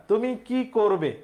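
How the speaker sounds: "তুমি কি করবে?" slow, with কি stressed and falling; teacher reading the question slowly. Shown as a demonstration. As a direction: lean on কি to show the long-i question word. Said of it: "কয় দীর্ঘইকার আছে".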